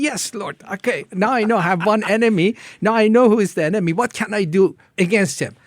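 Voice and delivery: fast, high, loud retold reply; fired-up eagerness. Believer learning who his one enemy is, fired-up. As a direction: answer eagerly, voice leaping up and racing.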